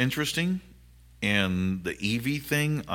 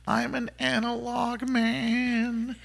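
high, slow, sung-out quote; [name] quote; much slower than usual. Retold or imitated. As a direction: drawl the line like a lyric. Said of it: "to quote".

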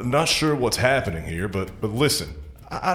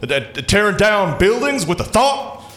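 frantic rising panic; 8 semitones higher than usual. Acted. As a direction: voice climbing higher, ever more frantic. Frantic rant about horrors.

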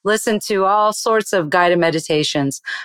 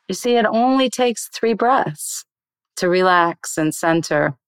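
ordinary delivery

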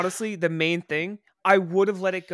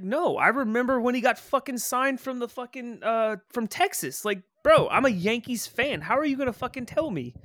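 higher-pitched retold protest; indignant arguing; 7 semitones higher than usual. Retelling his side of a Yankees argument, indignant. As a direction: argue back, incredulous and higher.

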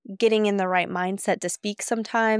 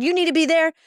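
higher and louder, blunt command; tough voice; 7 semitones higher, 4 dB louder than usual. Retold or imitated. Ordering Sam around at their first conference, tough bossy voice. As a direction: snap an order at a co-worker. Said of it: "bring out my tough voice".

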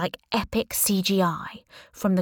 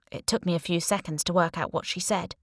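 ordinary delivery

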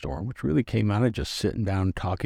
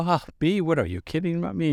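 mock disbelief; 9 semitones higher than usual. Retold own disbelief.

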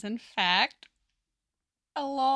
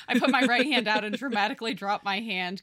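ordinary talk

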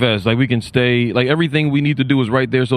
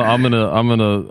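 ordinary delivery

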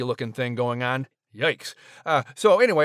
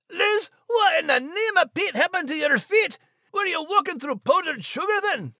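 much higher, teasing Scots voice; shocked Scottish Max; 14 semitones higher than usual. Acted. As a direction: gasp at her paws in a Scots brogue. Max reacts to Liz's paws.

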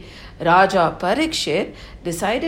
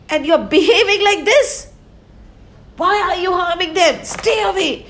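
high, louder stern scolding; angry king; 7 semitones higher, 5 dB louder than usual. Acted. King Parikshit scolding the evil man, high and stern. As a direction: scold the villain sharply, voice raised.

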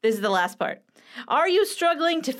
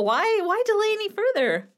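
excited; 10 semitones higher than usual. Acted.

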